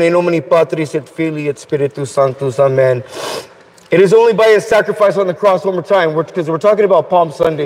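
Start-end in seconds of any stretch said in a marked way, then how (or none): 0.0-3.0 murmured church Latin; low solemn blessing. Acted. Closing blessing.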